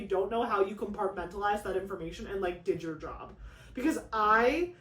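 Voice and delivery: ordinary talk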